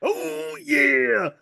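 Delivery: high, loud "Oh yeah" then whooping; Kool-Aid Man. Retold or imitated. Friend doing the Kool-Aid Man, mistaken for Ric Flair, loud and high. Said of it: "that was Kool-Aid Man".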